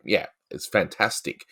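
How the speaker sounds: ordinary delivery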